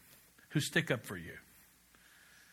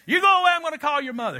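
much higher, louder and fast; retold threat; 12 semitones higher, 7 dB louder, faster than usual. Parents threatening the big brother, loud, high and quick. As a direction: rattle off the threat at a yell.

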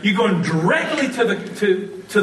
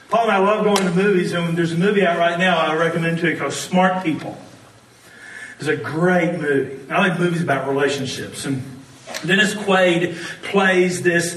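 normal talking voice

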